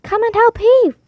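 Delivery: high call to come heave; calling for help. Acted. Wife calling a boy to help pull. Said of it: "called the wife to a boy".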